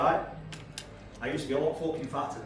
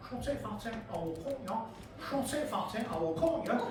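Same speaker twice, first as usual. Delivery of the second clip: soft, higher-pitched little chav; little scally voice; 4 semitones higher, 8 dB quieter, faster than usual. Acted. Little scally threatening the comedian, quiet high-pitched voice. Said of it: "This little fucking scally goes".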